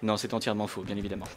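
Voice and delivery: soft deadpan correction; quiet aside. Real, not acted. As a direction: undercut the joke softly. Self-correcting joke. (aparté à voix basse)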